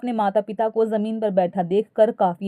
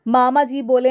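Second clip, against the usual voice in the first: ordinary delivery, nothing marked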